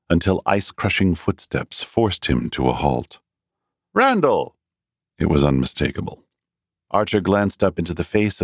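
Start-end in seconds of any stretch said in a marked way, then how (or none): 3.9-4.6 high call across the wind; hailing a friend from behind; calling out a name. A narrator's reading. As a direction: shout his name to stop him. Someone calls out across cold.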